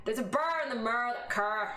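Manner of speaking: rolled Northern Irish burr on every R; Northern Irish accent. Shown as a demonstration. Streamer showing chat a Northern Irish accent. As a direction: do a Belfast accent with hard R's. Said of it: "what about Northern Irish?".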